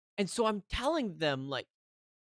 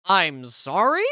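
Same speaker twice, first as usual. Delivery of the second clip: loud, slow, rising disbelief; incredulous; 2 semitones higher, 9 dB louder, slower than usual. Retold or imitated. Customer told to pay a cancellation fee, loudly incredulous. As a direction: throw it back as an indignant question. Acted incredulous reply to refusal.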